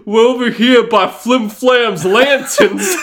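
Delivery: high, slow words broken by real laughter; cracking up while quoting the dad; laughing through it. Real, not acted. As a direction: barely get it out for laughing. Retelling dad's call, cracking up.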